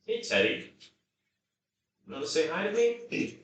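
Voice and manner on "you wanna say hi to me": pet voice. Real, not acted. Asking the dog to say hi, high baby talk. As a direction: coax the pup sweetly.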